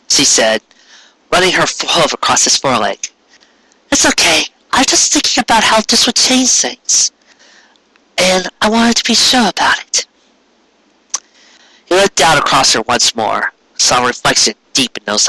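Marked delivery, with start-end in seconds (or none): 3.9-10.1 higher voiced wife reassuring husband; gentle wife voice. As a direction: soothe him kindly.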